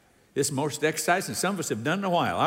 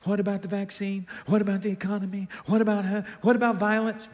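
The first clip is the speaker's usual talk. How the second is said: anxious rapid-fire questions; 4 semitones higher, much faster than usual. Acted. Voicing anxious objections in rapid list.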